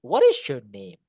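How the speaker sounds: "name" falling low; falling question intonation. Shown as a demonstration. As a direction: let the question drop to "name". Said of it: "Go down". (nada turun sampai "name")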